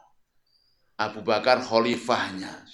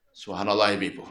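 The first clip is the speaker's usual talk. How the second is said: normal talking voice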